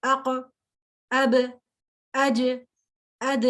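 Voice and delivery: اق, اب, اج, اد each a short syllable with a shaken, echoing stop; teacher isolates the bouncing qalqalah letters for students. Shown as a demonstration. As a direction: shake the last letter so it echoes. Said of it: "میں تھوڑا سا آواز کو ایکو دے رہی ہوں".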